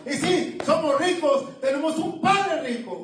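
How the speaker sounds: fervent preaching. Real, not acted. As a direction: preach it with lifted fervor. Preaching at raised pitch. (predicación fervorosa, con el tono elevado)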